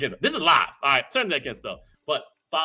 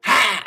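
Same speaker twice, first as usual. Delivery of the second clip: loud, high "ha!"; imitated haka shout; 7 semitones higher, 12 dB louder than usual. Host keen to learn haka, imitating the shout.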